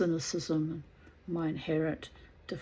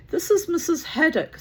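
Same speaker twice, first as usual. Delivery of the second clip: high, loud theatrical introduction; Margot, dramatic; 12 semitones higher, 9 dB louder than usual. A narrator's reading. Daughter introducing a guest to Mother, dramatic high voice. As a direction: announce the guest with great flourish. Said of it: "said Margot dramatically".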